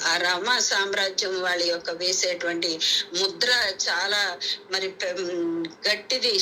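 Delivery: usual speaking voice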